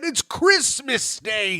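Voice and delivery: high, drawn-out theatrical reply; storybook passer-by. Acted. Passer-by answering Scrooge, hammed-up and high. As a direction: answer in hammed-up Christmas-tale style. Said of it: "I said".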